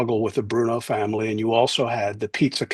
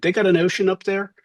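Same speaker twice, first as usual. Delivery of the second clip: higher-pitched, baffled Philly question; Philly accent; 7 semitones higher, faster than usual. Retold or imitated. Friend amazed by New Hampshire, Philly accent. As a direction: do a Philly street guy, rising at the end. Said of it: "he had the Philly accent".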